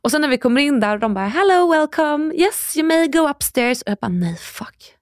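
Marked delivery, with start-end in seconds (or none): 1.3-3.8 högt, tillgjort välkomnande; servicerösten, högre och utdraget; härmad kabinpersonal. Retold or imitated. 4.1-4.9 viskad reaktion för sig själv; häpen viskning. Retold or imitated. Återger egen häpnad ombord.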